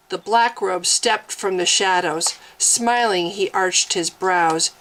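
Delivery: plain reading voice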